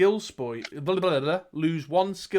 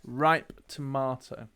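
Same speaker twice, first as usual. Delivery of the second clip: RIPE TOMATO said slowly and deliberately; slow, measured "ripe tomato" with falling end; spelled out clearly; 2 semitones lower, slower than usual. Shown as a demonstration. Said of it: "To be clear".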